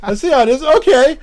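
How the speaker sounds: high, loud playful surprise; surprised laugh. Real, not acted. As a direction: react with mock outrage, laughing. Reacting to the joke challenge.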